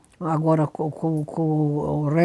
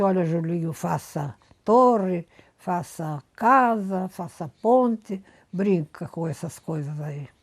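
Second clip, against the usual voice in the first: put-on slow coaxing to a child; slower than usual. Grandma instructing small grandson.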